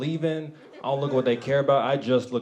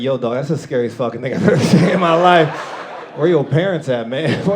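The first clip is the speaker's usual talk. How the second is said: louder, laughing incredulous yell; shocked outburst; 2 semitones higher, 8 dB louder than usual. Retold or imitated. Comic on subway reacting to a homeless man, loud laughing disbelief. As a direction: blurt it out half-horrified.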